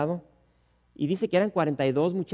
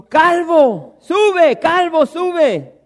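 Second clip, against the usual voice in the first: shrill, much higher, shouted taunt, the last "calvo, sube" dragged slowly; mocking youths; 12 semitones higher, 15 dB louder, much slower than usual. Youths jeering at the prophet Eliseo, a loud high singsong taunt. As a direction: jeer at the bald prophet like a pack of boys. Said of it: "se burlaban de él".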